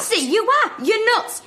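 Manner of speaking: put-on girl jeering at a rival; mocking jeer. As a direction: sing out the insult to needle her. Schoolgirl quarrel.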